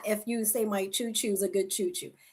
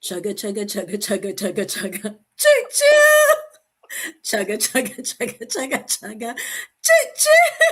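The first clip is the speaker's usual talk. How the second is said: chugging toy train voice; 3 semitones lower, 10 dB louder than usual. Pretend. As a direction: do a playful train, whistle at the top. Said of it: "You gotta do a train".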